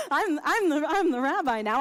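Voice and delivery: laughing loudly through the line, mock proud. Real, not acted. Rabbi tells congregation her old self, laughing loudly. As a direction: laugh it out like a cocky new rabbi.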